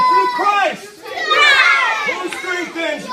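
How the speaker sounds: proclaiming. Shown as a demonstration. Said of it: "point to heaven".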